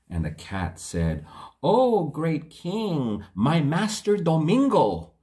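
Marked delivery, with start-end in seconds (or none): 1.6-5.1 fawning cat. Acted. Cat flatters the king.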